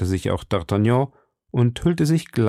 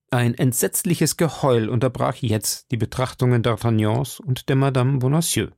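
ordinary delivery, nothing marked

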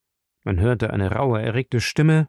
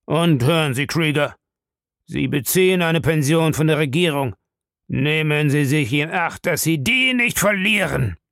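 put-on old man's raised, menacing voice; angry threat; 5 semitones higher than usual. A narrator's reading. Bellamy warns Krieger about his pension, angry and menacing. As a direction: warn him angrily, climbing at the end. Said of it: "fuhr der Alte böse auf".

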